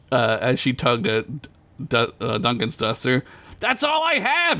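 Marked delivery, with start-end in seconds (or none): 3.6-4.6 high, exasperated protest; yelling back. A narrator's reading. Duncan answers Chickpea's demand, high protesting yell. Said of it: "he yelled back at her".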